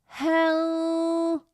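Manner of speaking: high, pinched nasal hel; nasal, closed throat. Shown as a demonstration. Teacher shows the wrong nasal L. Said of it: "now I'm closing the throat".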